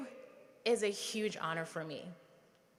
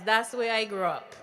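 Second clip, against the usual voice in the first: normal talking voice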